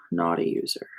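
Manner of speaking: soft whisper to herself. Real, not acted. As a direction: breathe it out as a whisper. Whispered note to self.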